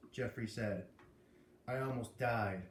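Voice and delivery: usual speaking voice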